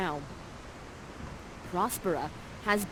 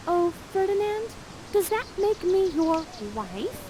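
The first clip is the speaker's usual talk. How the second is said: Miranda; 10 semitones higher than usual. Acted. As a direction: propose coyly in a high girlish voice. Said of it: "And then Miranda says".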